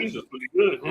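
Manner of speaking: light mock movie dub; mock kung fu dub. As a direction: imitate a badly dubbed kung fu actor. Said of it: "Nobody talks like that".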